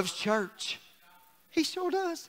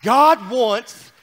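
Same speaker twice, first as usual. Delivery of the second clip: usual speaking voice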